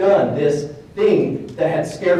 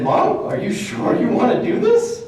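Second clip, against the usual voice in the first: high-pitched retold question; incredulous checking; 9 semitones higher, 3 dB louder than usual. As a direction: ask it high, doubting she means it. Son checks with frightened mother.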